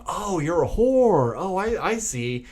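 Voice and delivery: slow, high imitation of dawning understanding; mock realization. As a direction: draw it out as a put-on knowing reaction. Voices creep learning the secret.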